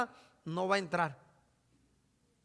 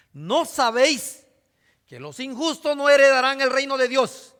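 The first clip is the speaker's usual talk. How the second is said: solemn scripture reading; 2 semitones higher, 6 dB louder than usual. Acted. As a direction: proclaim each word like a pulpit reading. Reads scripture aloud.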